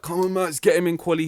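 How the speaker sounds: cheering on. Retold or imitated. Fan reliving the qualifying move, urgent cheering. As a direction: urge the driver on like a keen fan. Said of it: "I was cheering for, I was like".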